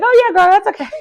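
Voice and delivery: high, breezy mock voice of a permissive mom; mock cool mom. Recapper mocking the mom who shrugs it off, in a high breezy voice. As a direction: mimic the cool mom brushing it off.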